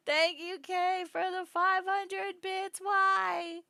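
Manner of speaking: high, slow, loud thanks; drawn-out teasing gratitude; mock-grand thanks. Real, not acted. Streamer thanking Kay for the donation, in a high, slow voice.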